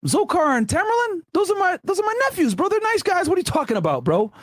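hammed-up uncle defending his nephews; an uncle waves off the accusation, stunned; mock surprised uncle. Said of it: "he's like".

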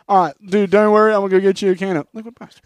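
loud, fast comforting dude; calm him down in a hurry; mock ad friend reassuring; hammed-up reassurance.

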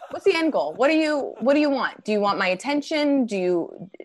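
mock-therapist patience, condescendingly measured; talking to a toddler. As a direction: coax a heckler like a small child. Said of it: "talk to them the way somebody might talk to a toddler".

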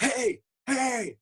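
high, drawn-out calling yell; retold yell. Left-behind casualty yelling after the others, high drawn-out yell. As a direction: yell out for help, pitch way up. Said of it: "so I yelled out".

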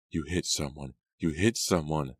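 ordinary delivery

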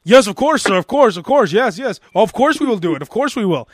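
fawning service voice, agreeing to everything; overly polite tire shop guy. Retold or imitated. Tire shop clerk eagerly reassuring a customer, fawning. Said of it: "dealing with the customers".

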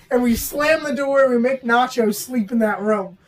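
usual speaking voice

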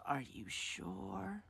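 very soft, drawn-out question; high, hushed mock-suspicious mom; suspicious probing. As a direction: probe quietly, stretching every word.

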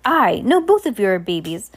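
a cheerful, scatterbrained captain contradicting himself; scattered cheerful captain. Acted. Captain greeting the orphans.

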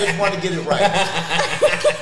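laughing. Real, not acted. Laughing while asking.

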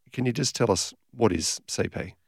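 ordinary talk

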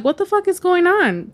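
high-pitched retold panic; freaking out. Woman recalling police cars outside at night, retold freak-out. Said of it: "I'm, like, freaking out".

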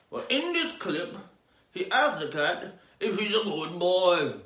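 normal talking voice

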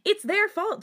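high, loud, defiant protest. A narrator's reading. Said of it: "soon raising his voice".

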